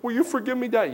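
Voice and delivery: high, pleading mock-child voice; imitated child. Child asking Dad for forgiveness, high pleading voice. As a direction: ask up high like a sorry kid.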